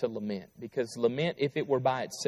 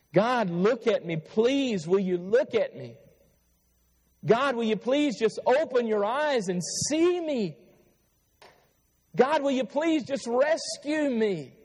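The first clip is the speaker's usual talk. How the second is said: higher, pleading cry to God; pleading plea; 9 semitones higher than usual. Shown as a demonstration. Preacher voicing a desperate plea to God. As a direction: beg God to look and rescue.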